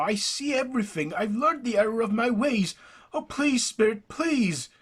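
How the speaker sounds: high, loud, anguished begging; desperate pleading. Acted. Jackson begging the silent spirit for mercy, anguished.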